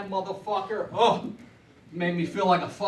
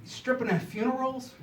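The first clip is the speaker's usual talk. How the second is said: higher, softer incredulous question; mock incredulous audience; 6 semitones higher, 4 dB quieter than usual. Comic voicing the crowd's disbelief, higher and softer. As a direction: lift it up in disbelief. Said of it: "I know what you're saying".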